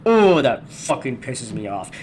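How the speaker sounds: ordinary talk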